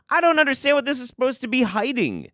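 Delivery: high-pitched incredulous complaint; exasperated bafflement. Acted. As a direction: push way up high in mock bafflement. Baffled by the supposed distraction.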